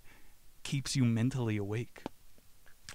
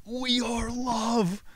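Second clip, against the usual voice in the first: very high, slow drawn-out exclamation; mock euphoric wail; ecstatic cry; 12 semitones higher, much slower than usual. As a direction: cry it out in tripping bliss. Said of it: "I was like".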